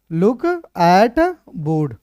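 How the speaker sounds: very slow, "look", "at", "board" set apart; word by word. Shown as a demonstration. Teacher writing out the translation for students, slow word-by-word dictation. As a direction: dictate it slowly with a gap after each word.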